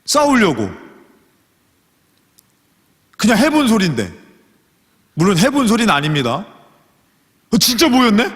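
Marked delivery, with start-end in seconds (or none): 3.2-4.1 sheepish excuse. Acted. 7.5-8.3 higher, startled double take; mock surprise. Acted shock at enemy gathering.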